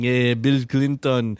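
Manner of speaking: slow, hammed-up Israeli accent. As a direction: drag it out in a mock Israeli accent. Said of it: "pretending you have an Israeli accent and going".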